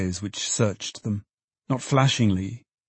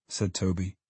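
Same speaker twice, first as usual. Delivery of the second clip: usual speaking voice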